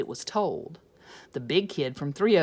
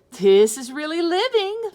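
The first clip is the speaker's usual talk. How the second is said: much higher, louder delight; delighted kids; 10 semitones higher, 11 dB louder than usual. Acted. Kids enjoying the movies, higher and louder. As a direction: savour it like spoiled kids.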